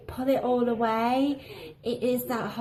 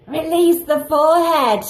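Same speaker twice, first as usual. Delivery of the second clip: loud, higher mock-heroic announcement; mock-dramatic proclamation; 6 semitones higher, 5 dB louder than usual. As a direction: proclaim it like a grand unveiling. Mock-dramatic announcement of her big step.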